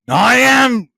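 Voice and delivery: loud, high dramatic declaration; Timothée Chalamet impression. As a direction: belt it out like Paul Atreides. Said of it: "the way Timothée said".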